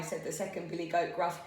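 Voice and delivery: ordinary delivery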